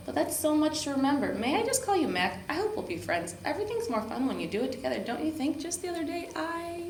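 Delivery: dog prattles on to the grumpy leprechaun; chatty dog voice. A narrator's reading. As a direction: babble on cheerfully without a break. Voicing Lucky chattering on.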